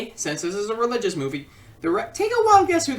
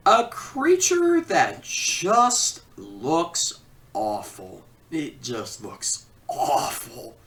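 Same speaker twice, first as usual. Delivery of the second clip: disgusted; 7 semitones lower, much slower than usual. Real, not acted. Disgusted at ugly creature.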